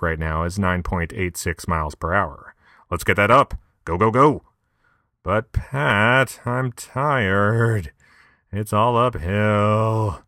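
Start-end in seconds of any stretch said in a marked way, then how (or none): 2.9-4.4 loud pep-talk coach; cheering himself on. Acted. 5.2-7.9 high whine; whiny tired self. Acted. Whiny reply to own coaching. 8.5-10.2 slow strained whine; cycling hard. Acted. Whining about the hill.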